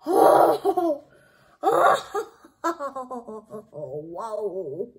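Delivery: can't believe it, laugh it out; laughing in disbelief. Real, not acted. Seeing the card for viewers, laughing in shock. Stunned by another pull.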